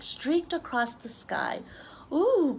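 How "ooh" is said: high loud excited ooh; raindrops cry out. Acted. Raindrops leaving the cloud, loud excited ooh. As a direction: squeal with delight as raindrops. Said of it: "cried all the raindrops".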